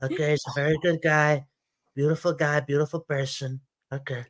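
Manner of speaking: Trump-style rally riff, repetitive and emphatic; Trump impression. Mocking right-wing talking points in a Trump impression. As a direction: do Trump, bragging and outraged by turns.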